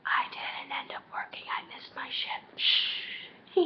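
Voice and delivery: hushed, guilty little confession; secretive whisper. Real, not acted. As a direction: whisper it like a secret, then shush. Whispers confession of missed shift.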